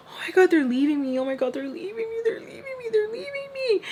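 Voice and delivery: hammed-up whimpering panic, climbing very high; freaking out. Girl left behind as the door closes, mock-crying panic. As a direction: rise into a squeaky mock wail. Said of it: "I was freaking out".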